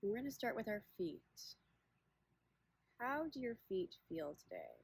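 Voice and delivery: unhurried meditation guide, long pauses between phrases; guided body scan. Acted. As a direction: guide listeners gently inward, leaving space after each question.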